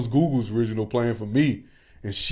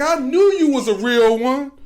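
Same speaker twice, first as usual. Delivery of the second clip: higher, louder mock praise; mock heckler; 5 dB louder than usual. Imitating the heckler flattering the friend, high mock voice. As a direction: lightly play the heckler sucking up.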